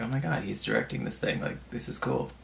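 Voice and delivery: hushed, starstruck inner voice; awed hush. Retold or imitated. To himself, seeing Spielberg direct: hushed awe. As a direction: breathe it out in quiet awe. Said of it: "being like".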